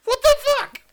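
comic falsetto outburst; high squeaky outrage. Retold or imitated. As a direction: screech it up high and loud. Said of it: "decide who you thought was saying".

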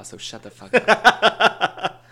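slow, high teasing snap; mock scolding. Teasing a beer snob friend.

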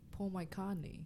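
"McCartney" as "my-COD-nee"; attempted British pronunciation. Shown as a demonstration. Learner gives an example word, "McCartney" as "my-codney". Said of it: "pronounce it in the British way".

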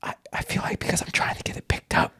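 whispered asmr role play. Pretend. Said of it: "we're just gonna whisper".

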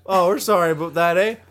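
loud hammed-up Canadian apology; polite Canadian with a cheery "eh"; mock Canadian. Host apologizes for long episode, mock Canadian accent.

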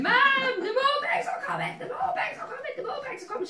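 very high, panicked kid imitation; hammed-up high-pitched warning cry to Mum; child's alarm. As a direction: squeal it like a child sounding the alarm. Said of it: "it was literally like an alarm".

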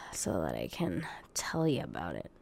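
soft whisper under the breath; whispered aside; muttered whisper. Real, not acted. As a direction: mutter it while busy searching. Muttering while searching.

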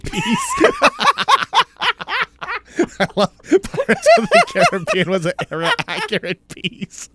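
high, laughing disbelief; cracking up. Real, not acted. Co-host teasing friend over the pirate film claim, cracking up. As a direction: talk through a big laugh.

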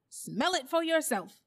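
high, loud challenge; insistent bulldog. A narrator's reading. Bulldog challenges the doubting animals, forcefully insistent.

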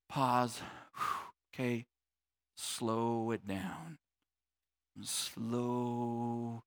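slow, hushed self-soothing; calming down. Shown as a demonstration. Preacher modeling a pause when things get intense, slow and calm. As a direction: breathe out and draw the words right out, low and soft.

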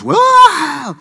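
a sudden high wail, shrieked out; high retold wail of a woman breaking down. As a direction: cry out as if collapsing in shock. Woman collapses crying out.

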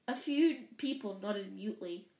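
usual speaking voice

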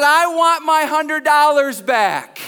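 high, loud and drawn out; hammed-up shout, every word stretched; acted furious demand. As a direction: demand it slowly at mock full fury. Wife's demand while choking woman.

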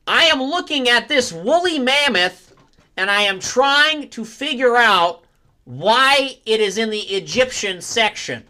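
high, loud, slow strange voice; drawn-out high odd voice; David Lynch. Acted. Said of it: "David Lynch going to".

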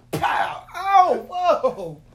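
loud bang, then high yelps; hammed-up shouted alarm; mock startled outburst. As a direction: act out getting hit mid-flight. Acts out being shot on broom.

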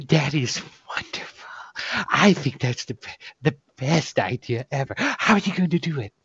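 whispery, awed game-master character voice; hushed delight at a grand plan. Acted. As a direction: gush in a breathy half-whisper, lifting into the question.

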